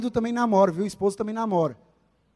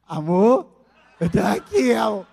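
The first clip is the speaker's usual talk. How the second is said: pleading sweetheart voice; 6 dB louder than usual. Retold or imitated. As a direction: beg them to stay on the line. Partner pleads on the call.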